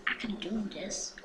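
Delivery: ordinary talk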